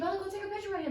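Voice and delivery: high-pitched gushing mom call; embarrassing mom. Retold or imitated. Mom urging Bella to photograph her crush, gushing. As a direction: pipe up high like an overeager mom.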